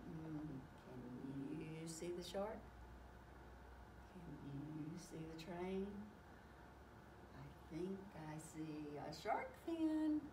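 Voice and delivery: low, hushed storyteller voice; softly, as if trying not to be found; hushed searching. Acted. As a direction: play hide and seek in a soft, low voice. Hide-and-seek, peeking for characters.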